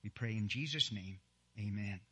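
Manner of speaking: ordinary delivery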